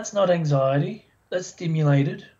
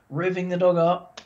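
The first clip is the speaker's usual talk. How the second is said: ordinary talk, nothing marked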